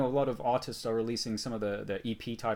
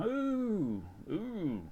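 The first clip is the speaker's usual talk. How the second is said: ooh; 9 semitones higher than usual. Real, not acted. Reacting to shutdown news.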